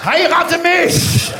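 high, drawn-out bellowed proposal; mock-commanding shout. Instructor proposing to a sergeant in the audience, bellowed. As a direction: bellow it like an order. Mock marriage demand to soldier.

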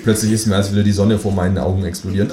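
usual speaking voice

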